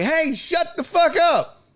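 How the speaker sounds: high-pitched, drawn-out mock yell; exasperated high-pitched retort, acted out; retold heckler comeback. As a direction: replay yelling back at a heckler, without full volume. Retelling his comeback to screaming heckler.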